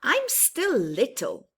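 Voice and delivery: lively storybook voice, rising on big moments; reading to children. Acted. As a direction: read it brightly to little ones.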